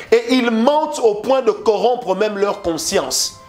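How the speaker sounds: usual speaking voice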